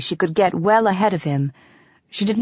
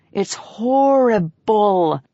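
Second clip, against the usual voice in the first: a moaning, long "horrible" with fore and aft stress; emphatic horrible; 3 semitones higher, much slower than usual. A narrator's reading. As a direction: stretch "horrible" into three heavy syllables. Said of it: "making the word an emphatic three syllables with fore and aft stresses".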